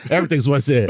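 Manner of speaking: ordinary talk, nothing marked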